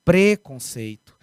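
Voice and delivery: Preconceito with closed e, /pɾe/, said slowly; Preconceito, closed e, slow and careful; closed pre. Shown as a demonstration. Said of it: "a palavra é o quê?".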